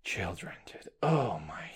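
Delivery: dramatic hushed whisper; theatrical whisper. Acted. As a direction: whisper it like a dark secret.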